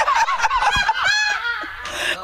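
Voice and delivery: high shrieking laugh. Real, not acted. Laughing at the TV advice, a high shrieking laugh. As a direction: burst into a high helpless laugh. Laughing at her own reaction.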